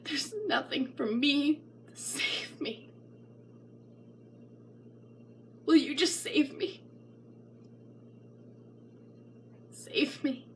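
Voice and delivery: breathless whisper, fading out; a soft broken whisper begging to be saved; whispered plea. Acted. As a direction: plead in a hushed whisper. Desperate plea after outburst.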